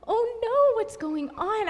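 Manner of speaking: replayed "oh no" moment; retold alarm. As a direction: think it aloud in dismay. Retold alarm on seeing police.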